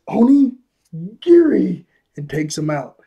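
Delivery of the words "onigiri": high, loud, drawn-out battle shout; anime-style sword attack call; attack cry. Retold or imitated. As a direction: yell the move's name, stretching each part. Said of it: "He does this".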